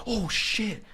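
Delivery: a sudden panicked yelp of dismay; shocked oh shit. Retold or imitated. Retelling the moment a guest spilled alcohol, alarmed yelp. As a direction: pitch jumps way up in alarm. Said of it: "and I was like".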